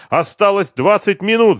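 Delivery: high, loud yell; full-voiced, high-pitched cry; shouted reply. A narrator's reading. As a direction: shout back urgently. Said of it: "закричал ему Машков".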